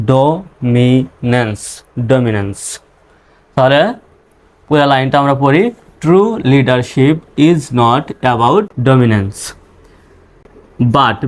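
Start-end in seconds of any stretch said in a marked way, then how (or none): 0.1-1.6 dominance very slow, in three separate parts; syllable by syllable. Shown as a demonstration. Teacher breaks word into syllables. 6.0-9.4 very slow, word by word; slow model reading. Shown as a demonstration. Teacher models reading the full line.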